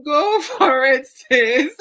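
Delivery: high, loud, laughing encouragement; laughing cheer. Real, not acted. Host egging a sister on, loud and laughing. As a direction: shout it through the laughter.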